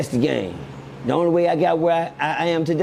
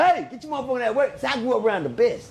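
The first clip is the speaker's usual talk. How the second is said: higher, lightly put-on hustler voice; Spencer voice; 8 semitones higher than usual. Speaker drops a line in his Spencer voice, higher and put-on. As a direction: toss it off like Spencer would. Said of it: "In my Spencer voice".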